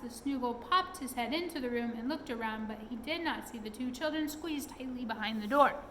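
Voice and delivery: ordinary delivery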